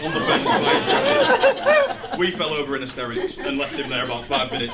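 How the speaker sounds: normal talking voice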